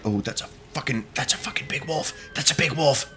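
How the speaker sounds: very quiet, wary muttering; hushed alarm. Real, not acted. Player spots a big wolf and says it under his breath, hushed. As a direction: keep it down, nearly whispering.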